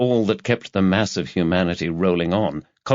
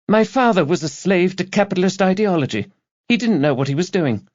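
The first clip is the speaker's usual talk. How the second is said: put-on high young woman's voice, preaching her politics; earnest young activist; 11 semitones higher than usual. A narrator's reading. Student activist lecturing the detective, high zealous voice. Girl defends herself.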